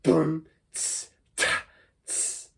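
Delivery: punchy beatboxed boom and tss; beatbox a basic pattern; beatbox kick, hat, snare. Shown as a demonstration. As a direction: mouth drum demo. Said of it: "you get that ta sort of sound".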